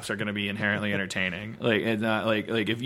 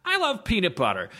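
high, earnest documentary-guy voice; 8 semitones higher than usual. Retold or imitated. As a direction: play a naive documentary host. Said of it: "documentaries about guys who go".

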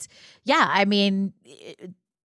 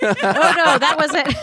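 laughing; 7 semitones higher, 3 dB louder, slower than usual. Real, not acted. Cracking up at the joke.

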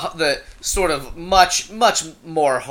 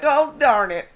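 mock dismay; 10 semitones higher than usual. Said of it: "And I'm like".

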